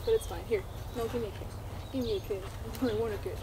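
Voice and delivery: usual speaking voice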